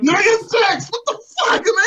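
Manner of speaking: high, loud exasperated outburst; raging out. Real, not acted. Fan venting to co-hosts about Nia Jax, raging. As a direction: let the frustration burst out in a high shout. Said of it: "take this time to just rage out".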